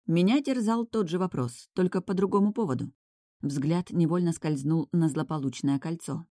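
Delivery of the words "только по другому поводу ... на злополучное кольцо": plain reading voice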